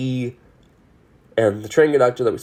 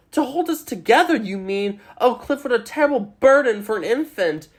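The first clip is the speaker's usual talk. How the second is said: high, arch mocking voice for Sally; 10 semitones higher than usual. Sally mocking Cliff about the baby, high and arch. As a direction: tease him with cool sarcasm.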